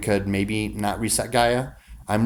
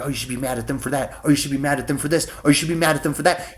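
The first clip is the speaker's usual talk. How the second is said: mocking nagging voices; 2 semitones higher, 3 dB quieter, much faster than usual. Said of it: "everybody's like".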